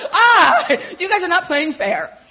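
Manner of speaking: high, loud laughing outburst; mock outrage, cracking up; laughing protest. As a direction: cry foul through laughter, loud and high. Retold outburst amid her laughter.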